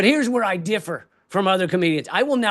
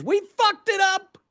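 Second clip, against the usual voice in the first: high-pitched, outraged cry; high, despairing yell; exasperated shout; 11 semitones higher than usual. Real, not acted. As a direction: yell it as the rant's climax. Exasperated climax of rant on humans.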